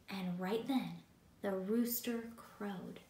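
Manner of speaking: normal talking voice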